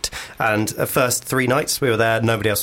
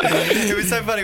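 laughing through it; helpless laughter; 8 semitones higher than usual. Real, not acted. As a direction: giggle the words out. Laughing recalling the fart.

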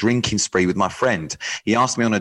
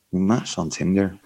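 attempted Irish accent; 4 semitones lower than usual. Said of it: "let's go for an Irish accent".

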